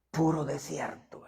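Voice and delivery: "Puro desierto" whispered, slowly; hushed slow whisper of "Puro desierto". Shown as a demonstration. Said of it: "Puro desierto (said first aloud)".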